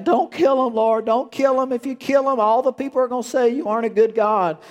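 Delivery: frantic pleading Moses. Retold or imitated. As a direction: beg the Lord to spare them. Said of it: "And Moses was like".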